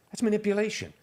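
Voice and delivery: manipulation thrown up high with emphasis. Real, not acted. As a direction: pitch the word manipulation up high and lean on it.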